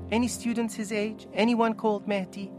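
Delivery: high, insistent questions; pleading mother. Acted. Mother asking guard after her son, pleading.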